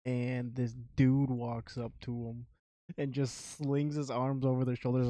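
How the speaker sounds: ordinary delivery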